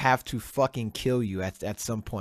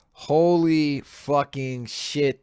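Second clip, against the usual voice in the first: shocked outburst; 3 semitones higher, 4 dB louder, much slower than usual. Real, not acted. Outraged exclamation.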